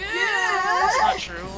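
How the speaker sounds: high, loud drawn-out denial; loud protest. Real, not acted. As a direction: shout it down, slow and stretched. Protests friend's teasing.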